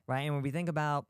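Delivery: ordinary delivery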